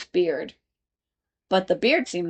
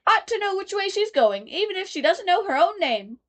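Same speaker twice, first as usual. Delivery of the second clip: much higher fussy gentleman; gentleman in paper; 11 semitones higher than usual. A narrator's reading. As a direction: lecture her primly. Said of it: "said the gentleman sitting opposite".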